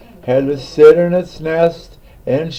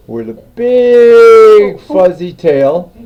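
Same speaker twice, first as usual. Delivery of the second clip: slow and louder, each word stretched; drawn-out emphasis; 4 semitones higher, 6 dB louder, slower than usual. A narrator's reading. Storyteller to listeners, lingering on the tail, slow and louder. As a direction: savour the big fuzzy tail.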